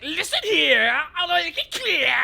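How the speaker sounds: King K. Rool voice. Retold or imitated. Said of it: "He's like".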